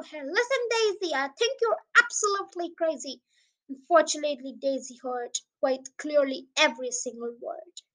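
lilting, sing-song poem reading; poem recitation. Acted. Podcaster reciting a children's poem to listeners, lilting sing-song. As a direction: read the rhyme with bounce and flair.

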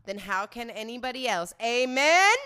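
hammed-up show sign-off, rising into the amen; mock-sermon rallying call. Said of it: "you know what they say?".

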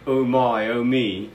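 put-on British exclamation; British accent. Co-host imagines a sleazebag of that era, British accent. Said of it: "why you give a British accent".

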